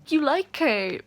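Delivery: high, loud teasing burst; gleeful loud accusation; excited teasing. Real, not acted. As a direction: call the character out, delighted. Teasing the character while reading.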